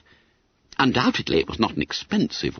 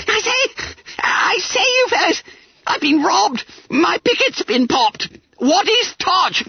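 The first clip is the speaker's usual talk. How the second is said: panicked yelling; slower than usual. Acted. Bunter yelling to his friends that he was robbed. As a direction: squeal it out in a blind panic. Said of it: "yelling at the top of his voice".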